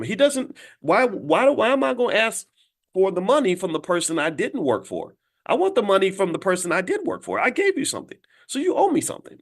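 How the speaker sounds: high, rising indignant protest; exasperated plea. Retold or imitated. Worker pleading with the boss for his pay, high and indignant.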